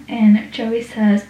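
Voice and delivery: ordinary talk, nothing marked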